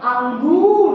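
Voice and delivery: अंगूर with a held गू; long oo. Shown as a demonstration. Teacher modelling अंगूर's long ऊ for the class. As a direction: hold the oo in अंगूर. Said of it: "थोड़ा देर के लिए बोला जा रहा है ना".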